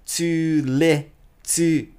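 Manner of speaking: slow "to let to" with the Ts run together; linked Ts. Shown as a demonstration. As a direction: glide from T to T. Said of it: "ティーティーが繋がって".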